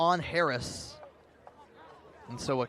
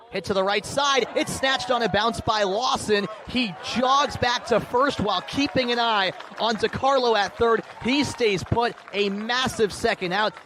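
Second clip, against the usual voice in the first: higher, louder rising play call; excited call; 6 semitones higher, 5 dB louder than usual. Real, not acted. Announcer calling the key infield play for listeners, excited. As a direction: ride the play as it unfolds, voice up. Big defensive play called live.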